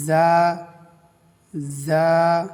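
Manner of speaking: loud model জা; Arabic letter za. Shown as a demonstration. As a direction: say জা short and clear. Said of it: "এক আলিফ টান হবে".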